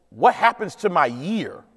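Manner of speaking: usual speaking voice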